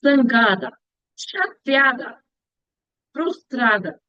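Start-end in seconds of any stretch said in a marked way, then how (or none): none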